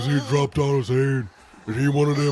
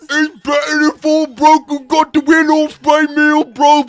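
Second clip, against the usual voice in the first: goofy British guy; 14 semitones higher, 10 dB louder than usual. Acted. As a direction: yell it like a dopey British fan. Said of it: "Mister Goofy British guy".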